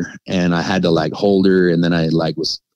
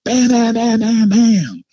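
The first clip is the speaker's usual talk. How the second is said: knocking sounds; slower than usual. Retold or imitated. Dad banging on cabin door.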